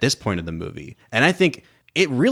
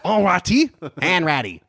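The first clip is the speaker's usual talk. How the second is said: mock French guards; 8 semitones higher, slower than usual. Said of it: "the French guys are going".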